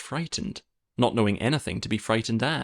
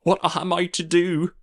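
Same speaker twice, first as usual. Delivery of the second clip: distressed cry; 7 semitones higher, 3 dB louder than usual. A narrator's reading. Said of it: "cried the nurse".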